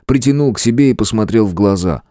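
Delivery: ordinary delivery, nothing marked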